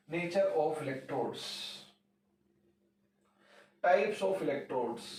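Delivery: slow dictation. Shown as a demonstration. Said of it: "dictating heading slowly".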